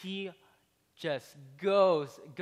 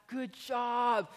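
mock congratulating; 7 semitones higher, much slower than usual. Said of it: "they come up to you, congratulating you".

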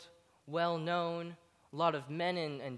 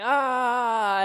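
a loud, high startled "Ah"; 7 semitones higher, 10 dB louder than usual. Retold or imitated. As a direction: gasp as if caught out. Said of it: "And you're like".

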